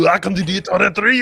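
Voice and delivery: put-on high, loud Austrian movie-star accent; Arnold Schwarzenegger impression. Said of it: "I can, I can do".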